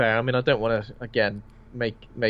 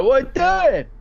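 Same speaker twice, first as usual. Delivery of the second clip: high, far-off voice of a sinking man, pitched well up; a man sinking into the floor cries out in alarm, voice high; startled victim; 4 dB louder than usual. Acted.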